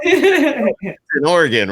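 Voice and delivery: amused laugh while teasing a friend. Real, not acted. Joking about his wandering mind.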